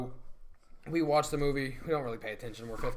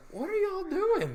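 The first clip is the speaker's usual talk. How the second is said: very high, slow disbelief; incredulous squeak; 4 dB louder, slower than usual. Retold or imitated. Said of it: "and I was like".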